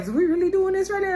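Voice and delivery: high, fast squeak of disbelief; mock-panicked host pleading; alarmed disbelief. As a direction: pitch way up like the host can't believe it.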